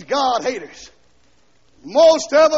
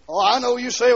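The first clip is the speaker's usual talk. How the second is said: ordinary delivery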